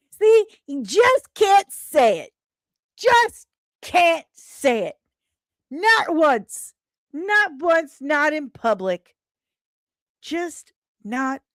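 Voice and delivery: high, loud mock voice of a reluctant partner; mocking impression. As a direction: tease him by parroting his line. Mocking someone who never says it.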